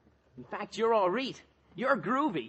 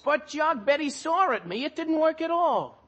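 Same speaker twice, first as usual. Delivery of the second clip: higher-pitched whining protest; 6 semitones higher, 3 dB louder than usual. Acted. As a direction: argue your case, aggrieved. Boy protests losing the bet.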